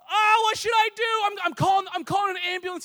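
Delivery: very high, loud mock panic; panicked yelling. Son-in-law panicking over the dislocated shoulder, acted high yell. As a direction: shriek it like someone in a flap. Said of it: "I'm yelling too".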